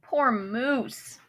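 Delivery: ordinary delivery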